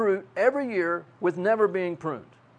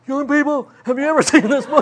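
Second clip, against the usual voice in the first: put-on higher, laughing old-teacher voice; lightly hammed elderly preacher, laughing; mock old man; 8 semitones higher, 5 dB louder, faster than usual. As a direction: play himself as the old guy now. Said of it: "Now I'm one of those old guys".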